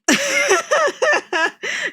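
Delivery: high-pitched burst of laughter; amused laugh. Real, not acted. Streamer reacting to the video's joke, amused high laugh.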